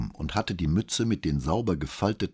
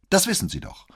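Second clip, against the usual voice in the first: high, louder curt retort; brusque; 13 semitones higher, 5 dB louder than usual. A narrator's reading. Boy answering the commissario's needless question, brusque. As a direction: throw it back at him brusquely. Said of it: "erwiderte der Junge schroff".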